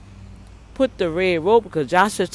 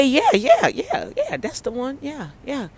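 much higher, breezy nurse voice; high, offhand yeah yeah; imitating the nurse; 8 semitones higher than usual. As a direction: mimic the nurse brushing him off.

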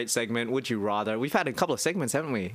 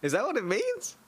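much higher, teasing doubt; mock disbelief; 12 semitones higher than usual. Real, not acted. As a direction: squeak it out, playfully doubtful.

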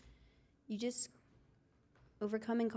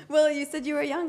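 laughing; 6 semitones higher, 9 dB louder than usual. Real, not acted. Defends herself laughing.

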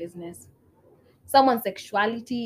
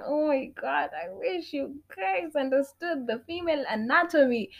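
normal talking voice

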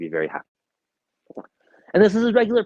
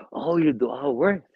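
slow, higher, playful wonder; mock amazement; 5 semitones higher, slower than usual. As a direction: fake surprise for a child. Father feigns amazement to child.

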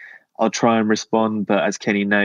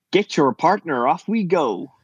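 high, hammed-up Southern square-dance caller; attempted Tennessee accent; 7 semitones higher than usual. Shown as a demonstration. Guest's line dancing call, high put-on Tennessee accent. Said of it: "give me your best, uh, Tennessee accent".

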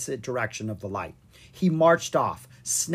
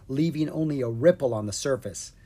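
plain reading voice

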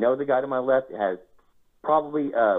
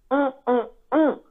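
repeated high-pitched comic "mm"; Dave Chappelle mock hum; 4 dB louder, slower than usual. Player gloating over his winning card, comic high hums. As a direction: let out high, savoring little hums. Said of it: "like fucking Dave Chappelle".

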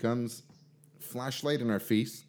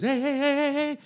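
shrill high yelled hey, much higher and louder; retold scream; 4 dB louder than usual. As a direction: yell it like a panicked cop at full pitch. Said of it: "she screams".

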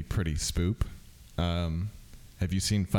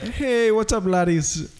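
high, shouted Irish greeting; Irish YouTuber intro; 11 semitones higher, slower than usual. Retold or imitated. Showing friend the Irish accent, shouted Irish greeting. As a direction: mimic a hyped Irish video intro. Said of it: "that's his intro that you just said".

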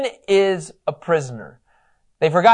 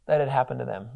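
ordinary delivery

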